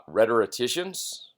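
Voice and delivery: ordinary talk, nothing marked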